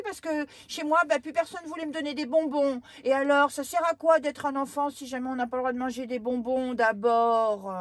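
high, loud whiny complaining; indignant little girl. Acted. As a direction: sulk loudly like a spoilt child. Girl's indignant rant.

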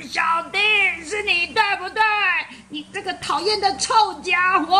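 high-pitched mock-furious sister scolding; big sister yelling at smelly brother; angry big sister. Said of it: "他气到想要打他".

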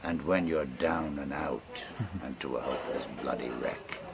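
rolling bush-poem recital, relishing every line; ballad recitation. Acted. Storyteller reciting a bawdy ballad to friends, in a rolling recital voice.

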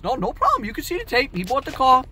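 high-pitched, confident imitation; insistent manager.

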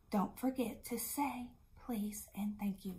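soft whispered reminder. Shown as a demonstration. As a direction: whisper it gently to the children. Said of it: "whispered reminder".

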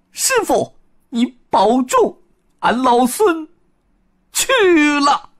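high Monkey King voice, parting call; Monkey King farewell. Acted. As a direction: call out a sad goodbye to the master. Wukong's farewell cry.

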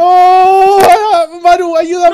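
panicked shout for help; being chased. Real, not acted. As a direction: yell for help while fleeing. (grito de pánico al ser perseguido)

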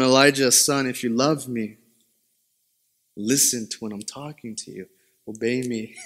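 low, soft retold admonishment; quiet fatherly reply. Father asking his son to obey, quietly firm. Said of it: "And I respond".

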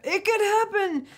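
very high, drawn-out playful voice; squeaky, stretched wishful aside; high-pitched hopeful. Pretend. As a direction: sing it out high and teasing. Repeats it in exaggerated high voice.

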